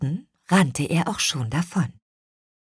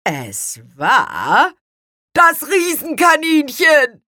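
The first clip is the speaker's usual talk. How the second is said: teasing lead-in, then high, loud announcement; gleeful loud reveal; sly triumphant reveal; 10 semitones higher, 7 dB louder, slower than usual. A narrator's reading. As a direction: build suspense, then trumpet the culprit. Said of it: "Spargelzahn lächelte listig".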